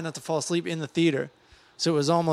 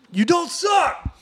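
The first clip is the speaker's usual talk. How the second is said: high, slow, mean yell; mean shout; 4 dB louder, much slower than usual. Shown as a demonstration. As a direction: bellow it at him, slow and nasty. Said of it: "Say it real mean".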